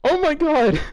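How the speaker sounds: high, loud burst of disbelief; shocked outcry. Real, not acted. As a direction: yelp it out in horrified laughter. Shocked by what he reads.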